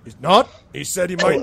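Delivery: loud, high-pitched startled question; surprised troll. Acted. Troll told he is no elf, loudly surprised. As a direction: blurt it out in loud disbelief.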